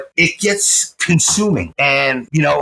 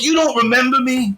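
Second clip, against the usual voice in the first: high-pitched imitated disbelief; incredulous; 8 semitones higher than usual. Old pupil to the man who forgot him, acted disbelief. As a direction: ask it high, as if amazed to be forgotten. Brady hurt at being forgotten.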